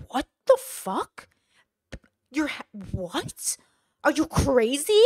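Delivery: slow, stretched-out disbelief in a put-on voice; mock incredulity. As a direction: imitate someone appalled and baffled, word by drawn-out word. Acting out shocked, uncomprehending listeners.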